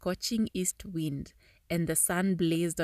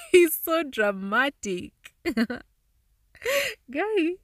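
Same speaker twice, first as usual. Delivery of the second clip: laughing; 9 semitones higher, 7 dB louder, slower than usual. Real, not acted. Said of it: "laughs Guy".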